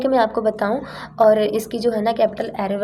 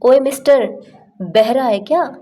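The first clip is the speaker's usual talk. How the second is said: louder, higher rude call; with attitude; 3 semitones higher, 5 dB louder than usual. Shown as a demonstration. Annoyed at a stubborn person blocking the way, rude and loud. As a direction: snap at him for not moving. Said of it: "थोड़े attitude में आते हैं".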